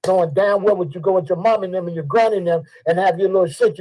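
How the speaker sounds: ordinary talk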